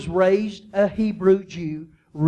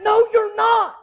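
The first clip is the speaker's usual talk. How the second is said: high, loud, flat-out denial; disbelieving brothers; 11 semitones higher, 3 dB louder than usual. Acted. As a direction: snap back in disbelief. Brothers refuse to believe him.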